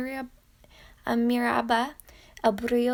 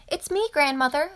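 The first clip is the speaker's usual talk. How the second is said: raised, higher call through the door; 7 semitones higher, 4 dB louder than usual. A narrator's reading. Girl calling to grandmother at the open door, raised call. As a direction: call into the house. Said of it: "she called".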